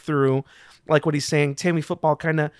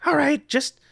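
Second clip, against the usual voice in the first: put-on high-pitched kid giving in; sighing kid; 7 semitones higher, faster than usual. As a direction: sigh and relent in a high young voice. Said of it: "sighs and he's like".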